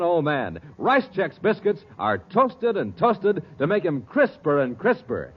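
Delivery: normal talking voice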